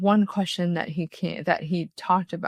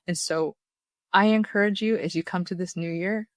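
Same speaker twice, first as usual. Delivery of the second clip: ordinary delivery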